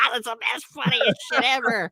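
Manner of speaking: high, squealed words through laughter; helpless high cackle while talking; hysterical laughing impression. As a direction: mimic your own stoned laughing fit. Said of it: "I remember being like".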